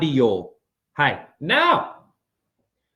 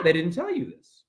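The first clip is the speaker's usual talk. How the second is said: normal talking voice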